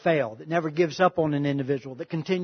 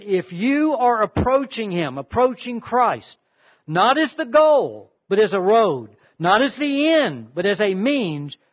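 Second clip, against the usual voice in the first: slow, loud, each contrast hammered home; emphatic preaching; 2 semitones higher, 4 dB louder than usual. Acted. Pastor reading Lewis's quote to the congregation, slow and emphatic. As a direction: preach every "not as" line like a revelation. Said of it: "preached C S Lewis quote".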